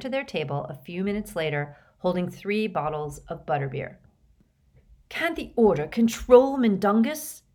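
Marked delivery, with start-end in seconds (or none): 5.1-7.4 loud, high stage-whispered fury; fierce, pitched-up indignant demand; furious whisper. A narrator's reading. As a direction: demand it furiously, as if trying to hush. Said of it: "in a furious whisper".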